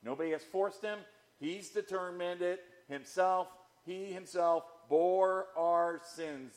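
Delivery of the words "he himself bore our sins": "bore" stretched out, slow and weighty; slow emphatic reading. Shown as a demonstration. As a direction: press each word of "He himself bore our sins". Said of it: "the emphatic, personal pronoun".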